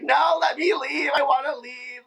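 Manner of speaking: high, loud, drawn-out cry of I wanna leave; retold desperate shout. Queen retells begging producers to leave the stage, high pleading shout. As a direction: relive the breakdown, begging to go. Retold onstage meltdown, crying.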